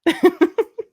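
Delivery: high burst of laughter at his own joke. Real, not acted.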